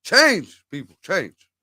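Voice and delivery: a hint of Obama's speech cadence; Obama impression. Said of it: "I've been working on my Obama voice".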